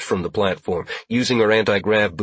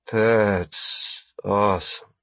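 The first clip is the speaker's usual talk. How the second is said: drugged; much slower than usual. Acted. Just drugged with hypo spray.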